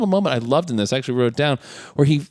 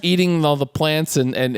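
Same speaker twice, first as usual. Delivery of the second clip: ordinary talk, nothing marked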